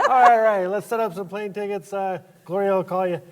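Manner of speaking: high, laughing producer voice; laughing all right. Retold or imitated. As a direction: answer laughing and businesslike.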